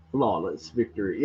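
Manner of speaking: low, hushed mock announcer; lightly voiced game announcer. Mimics game announcer's catchphrase.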